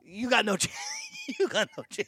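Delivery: laughing through the words. Real, not acted. Host ranting about Australian men to co-host, laughing. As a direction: crack up while saying it. Cracking up at own Australian rant.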